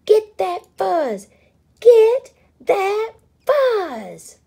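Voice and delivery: high screaming battle cry, slowing; rally the mob to grab it; a frantic shrieking chant; war cry. Acted. War cry over fuzz.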